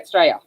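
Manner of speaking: "Australia" cut down to STRAY-a; two-syllable Straya. Shown as a demonstration. Showing how we say it, "Australia" as Straya. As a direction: drop the Au and the l in "Australia". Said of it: "That's how we say it".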